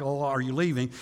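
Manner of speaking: ordinary delivery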